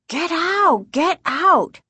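high-pitched, forceful shouts; two high, urgent yells; scream. A narrator's reading. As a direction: scream at an animal to chase it off. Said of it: "Casey screamed".

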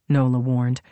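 ordinary delivery, nothing marked

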